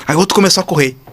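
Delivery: usual speaking voice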